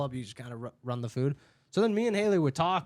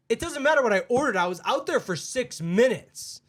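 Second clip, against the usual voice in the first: higher, louder indignant customer; mock freak-out; 8 semitones higher, 4 dB louder than usual. Customer freaking out at Haley over her order, mock outrage. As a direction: imitate a customer losing it over a delay. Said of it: "she's, like, freaking out".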